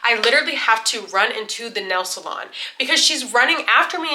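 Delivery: ordinary delivery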